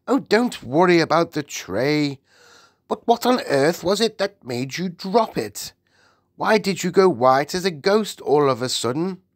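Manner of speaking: high, emphatic royal questioning; insistent Queen. Acted. The Queen asks why the maid dropped the tray, insistent. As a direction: press the maid for an answer.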